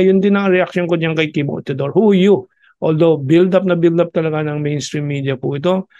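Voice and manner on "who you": loud, high, dismissive "Who you?"; mocking retort. Talking back at the new defense secretary, a loud scoffing retort. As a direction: scoff at him like he's a nobody.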